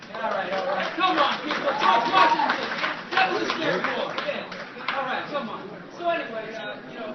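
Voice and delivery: ordinary talk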